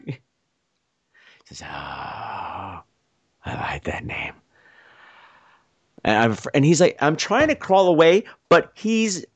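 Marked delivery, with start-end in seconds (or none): 1.6-4.3 very soft, low whisper; husky voice. Retold or imitated. Stranger on top of him savours the name, husky whisper. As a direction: breathe it creepily into the ear. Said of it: "there's this husky voice in my ear".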